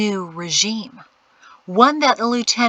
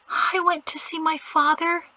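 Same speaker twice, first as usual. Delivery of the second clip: put-on young woman voice; 9 semitones higher than usual.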